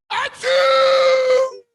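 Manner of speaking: usual speaking voice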